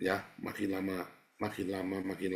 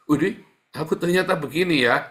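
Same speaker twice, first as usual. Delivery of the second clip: surprised; 7 semitones higher, 10 dB louder than usual. Acted. Acted shocked self-realization.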